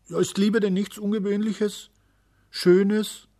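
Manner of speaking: high, loud little voice; earnest, high-pitched appeal; pleading child. A narrator's reading. Little day longs to object.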